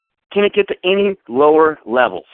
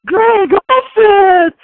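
high shrill-free mock cheer, much higher and louder; 6 dB louder, much slower than usual. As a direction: jump up cheering like a giddy fan. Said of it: "Everybody's jumping up going".

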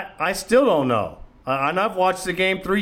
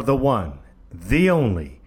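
announcer introduction; 4 semitones lower than usual. Real, not acted. Host introduces the next caller.

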